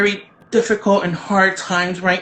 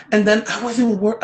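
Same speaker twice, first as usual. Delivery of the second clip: ordinary talk, nothing marked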